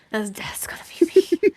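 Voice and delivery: harsh whisper of dread. Real, not acted. Streamer whispering in horror at the statement. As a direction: hiss it out in disbelief.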